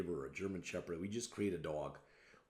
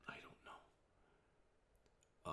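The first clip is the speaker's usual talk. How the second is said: whispered aside; 19 dB quieter, much slower than usual. Real, not acted. Unsure pause within quoted question.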